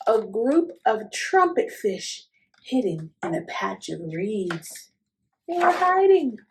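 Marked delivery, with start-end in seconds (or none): 5.5-6.4 high, loud playful reveal. Acted. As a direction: tell kids a secret excitedly. Hushed story aside to children.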